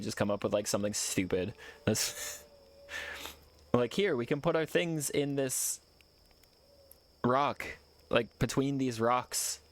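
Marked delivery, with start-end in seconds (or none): 3.9-9.6 slow mock-serious pitch. Slow mock-proud suggestion.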